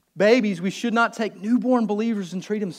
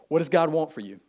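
normal talking voice

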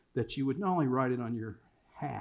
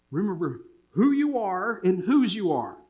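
a dad's firm, higher, louder reminder; father's charge; 5 semitones higher, 5 dB louder than usual. Retold or imitated. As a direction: stress on "who" and "whose", pitched up. Father's parting charge to son.

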